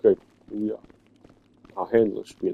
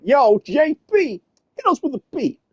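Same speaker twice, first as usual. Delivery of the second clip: 2000s rapper. Retold or imitated. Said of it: "every rapper in the two thousands said that".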